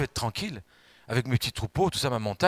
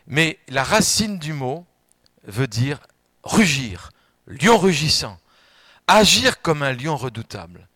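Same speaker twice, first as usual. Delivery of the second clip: rising preaching; 7 dB louder, slower than usual. Acted. Preaching about the roaring lion.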